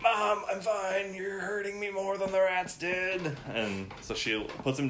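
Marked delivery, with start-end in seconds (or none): 0.0-3.4 put-on high, slow boy's voice, squeezed too hard; embarrassed boy. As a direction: whine like a squashed kid. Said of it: "he's like".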